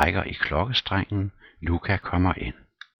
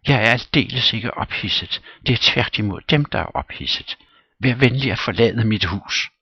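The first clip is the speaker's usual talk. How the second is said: high, loud offended lady's voice; haughty young widow, heated; indignant widow Popova; 6 semitones higher, 6 dB louder than usual. Acted. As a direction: snap back at him, wounded pride. Popov arguing back.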